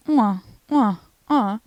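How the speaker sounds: loud smacking air kisses; mock kisses. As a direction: blow three playful kisses. Kiss noises for reunion.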